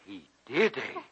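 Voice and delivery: usual speaking voice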